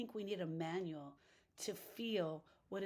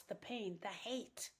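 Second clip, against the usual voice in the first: ordinary talk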